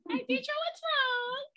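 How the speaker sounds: high, slow, caring mentor on a call; mentor's voice. Retold or imitated. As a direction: play the mentor picking up the phone, half crying. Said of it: "she be like".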